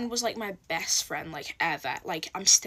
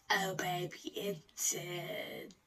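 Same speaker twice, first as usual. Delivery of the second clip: ominous whisper; 2 semitones lower, 10 dB quieter, much slower than usual. Real, not acted. Ominous aside to viewers.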